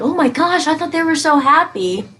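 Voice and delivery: a touch of fake astonishment; mock shock. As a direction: play the stunned bystander, lightly mocking. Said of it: "you're like".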